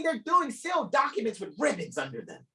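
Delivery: ordinary talk, nothing marked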